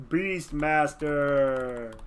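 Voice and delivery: very slow, loud, drawn-out reveal; stretched-out, booming fanfare delivery; mock announcement. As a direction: announce it like a grand title. Announces result with mock grandeur.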